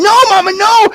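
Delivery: very high begging no; pleading boy Jack. Acted. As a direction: beg her not to throw the beans. Jack begs mother.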